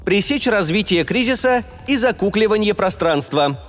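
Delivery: cold, official ship voice, high; spaceship. A narrator's reading. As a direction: rap it out like an official machine. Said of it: "холодно официальный голос корабля отчеканил".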